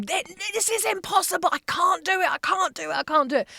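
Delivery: high, desperate cry of I can't do it; retold frantic wail. Alone at the computer, reliving the frantic breakdown. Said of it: "I remember screaming".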